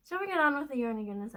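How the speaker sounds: eager segue to the yarn; cheery. Real, not acted.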